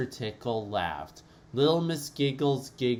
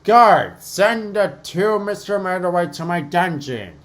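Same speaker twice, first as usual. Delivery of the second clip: loud, higher furious command; furious order; 8 semitones higher, 10 dB louder than usual. Acted. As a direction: bark the order at the guard. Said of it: "he snapped".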